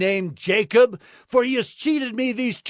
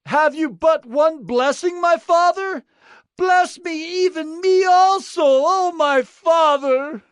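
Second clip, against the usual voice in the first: very high, wailing son's voice; weeping plea; 3 dB louder than usual. A narrator's reading. Son begs father for one more blessing, weeping wail. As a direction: lift the voice in tearful pleading. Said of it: "Esau lifted up his voice and wept".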